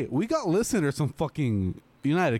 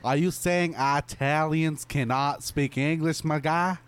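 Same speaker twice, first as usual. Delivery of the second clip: put-on dumbass Southern accent. Cohost teasing his friend in a mock Southern accent. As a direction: lay on a dumb Southern accent, slowly. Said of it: "why are you saying this in a dumbass Southern accent".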